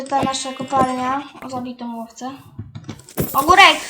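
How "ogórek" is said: sudden loud call of warning; alarmed shout. Real, not acted. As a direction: shout it out louder, in real alarm. Warning as game creature appears. (głośny okrzyk ostrzegawczy)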